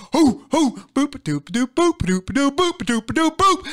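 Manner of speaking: hoots into bouncy boop-a-doop scat; punchy rhythmic mouth noises, rising to the last boop; playful hoots and scatting. Real, not acted. Host fills the halftime break, playful scatting. Host makes nonsense hype sounds.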